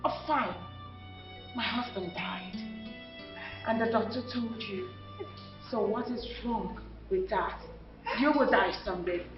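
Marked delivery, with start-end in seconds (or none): 1.5-7.6 cool dismissal. Acted. Widow brushing off her late husband's brother, coolly.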